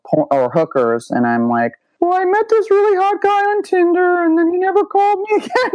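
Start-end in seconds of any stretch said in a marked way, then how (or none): 2.0-5.7 very high, squeaky self-parody; hammed-up pitiful complaint; mock girlish whine. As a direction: wail it in a tiny high voice. Acting her whiny share at meeting.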